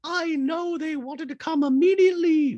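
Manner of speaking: very high squeaky voice with beep boop robot noises; high mock robot Dorit. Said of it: "Dorit in a talking head goes".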